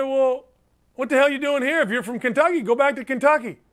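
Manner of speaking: high, challenging comeback; retold argumentative retort. Host retelling his retort to a Kentucky fan, indignant. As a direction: fire back in mock indignation.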